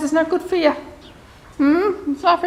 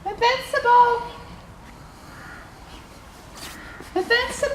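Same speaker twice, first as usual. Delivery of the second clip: high carrying name call; 7 semitones higher than usual. Real, not acted. Speaker calls Invincible over, high calling out. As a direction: call a horse from afar, pitch raised.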